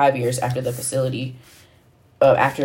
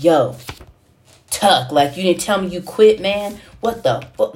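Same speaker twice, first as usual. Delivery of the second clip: retold angry rant; 4 semitones higher, slower than usual. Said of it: "he was going in".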